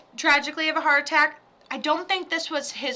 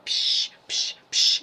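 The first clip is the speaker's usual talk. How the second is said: short bursts of "psh"; hissing spurts like a leak; imitated leak noise; slower than usual. Shown as a demonstration. Imitates a leak with her mouth.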